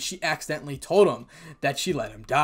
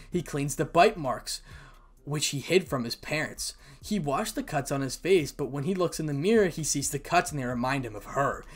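ordinary delivery, nothing marked

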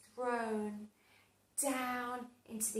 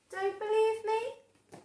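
teasing challenge; 10 semitones higher, 5 dB louder than usual. Acted. Teasing aside to listeners.

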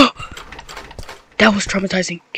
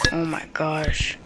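slow scared whisper; 4 semitones lower, 14 dB quieter, slower than usual. Real, not acted. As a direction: breathe it out in fear. Hiding in dark game.

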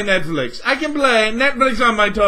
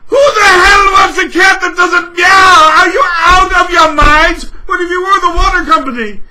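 loud, higher yelling in disbelief; outraged shout; 7 semitones higher, 10 dB louder than usual. Real, not acted. Berating Microsoft over the cat that won't meow, outraged shout. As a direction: shout it at them, incredulous.

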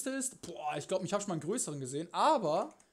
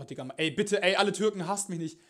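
usual speaking voice

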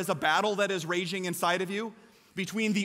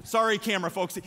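ordinary talk, nothing marked

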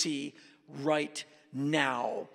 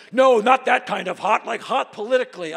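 stern officer; 7 semitones higher, 11 dB louder than usual. Retold or imitated. Customs officer correcting him.

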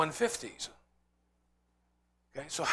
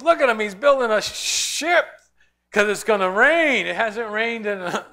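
higher, louder jeering at the fool building a ship; mocking ridicule; 5 semitones higher, 7 dB louder than usual. Mockers ridiculing Noah at his ship, loud jeering. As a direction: sneer at him for the crowd. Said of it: "ridiculed him and laughed at him".

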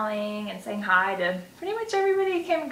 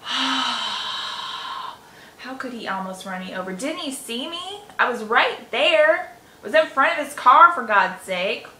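angry venting; 3 semitones higher, 7 dB louder than usual. Shown as a demonstration. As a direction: let the anger out while tapping. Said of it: "remember to breathe".

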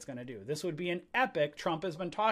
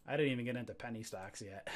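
quick, softer, through a laugh; chuckling aside, lower and quicker; 4 semitones lower, 6 dB quieter, faster than usual. Real, not acted. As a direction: laugh at yourself for wandering off. Laughing at his own digression.

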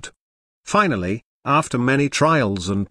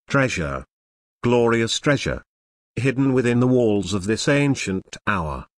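ordinary delivery, nothing marked

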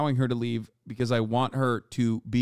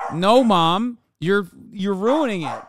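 higher, louder mock whine; exasperated protest; 7 semitones higher, 6 dB louder, slower than usual. Daughter replays protesting to her mom, higher and louder. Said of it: "I'm like".

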